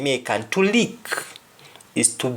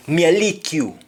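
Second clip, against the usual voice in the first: higher, punchy Jamaican Patois; 6 semitones higher than usual. Shown as a demonstration. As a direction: say it in Patois, pitch jumping up on "lick". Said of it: "under example we say".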